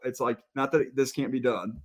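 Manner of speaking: ordinary delivery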